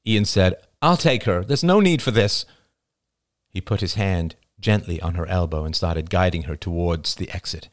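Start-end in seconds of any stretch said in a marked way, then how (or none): 0.8-2.4 higher, insistent voice; urgent peacemaking tone; firm intervention. A narrator's reading. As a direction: step in and insist, pitched up. Ian intervenes with guards and crowd.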